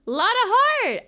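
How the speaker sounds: "Lot of heart" shouted high and bright; excited exclamation. Shown as a demonstration. Streamer realizing the name's pun, exclaiming excitedly. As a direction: cry out "Lot of heart!" delighted.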